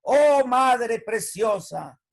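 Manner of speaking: slow, drawn-out flattering greeting; butter up mamita, stretching every word; loud, slow, pleading flattery; syrupy sweet-talk to mom. Retold or imitated. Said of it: "Imagínense que uno hablara, yo todos los días saludara".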